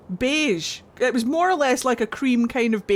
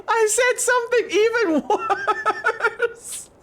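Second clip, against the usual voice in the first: high, slow words squeezed out through laughter; laughing through it; 11 semitones higher, slower than usual. Real, not acted. Telling co-host the worse thing she said, slow laughing squeal. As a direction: barely get it out for laughing.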